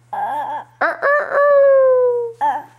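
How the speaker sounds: high crowing, climbing sharply; rooster crow. Pretend. Parent answers for the rooster, crowing. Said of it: "What does a rooster say?".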